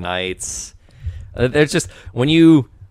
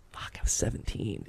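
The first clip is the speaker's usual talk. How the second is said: whispering; 15 dB quieter than usual. Real, not acted. Aside, unvoiced.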